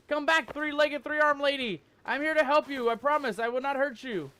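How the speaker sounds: loud, high pleading call after her. Real, not acted. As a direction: shout after her, begging her to come back. Calling after the fleeing woman.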